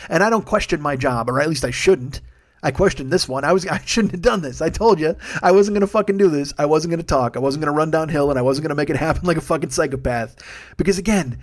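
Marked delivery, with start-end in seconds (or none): none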